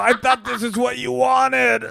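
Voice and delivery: high, strained wailing protest; mock anguished wail. Wife acting out a revenge fantasy on her husband, mock wailing. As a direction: wail it like a hammed-up revenge scene.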